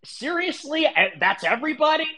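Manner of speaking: high, falling scoff of disbelief; incredulous. Real, not acted. Streamer mocking critics' claim again, incredulous high scoff. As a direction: throw it back at them, exasperated.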